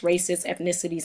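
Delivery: normal talking voice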